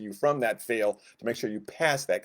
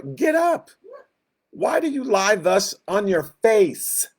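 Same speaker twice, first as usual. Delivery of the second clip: incredulous God; 8 semitones higher, 4 dB louder, slower than usual. Retold or imitated. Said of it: "And that's hilarious to me".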